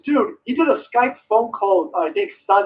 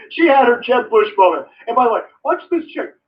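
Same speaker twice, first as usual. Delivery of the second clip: ordinary delivery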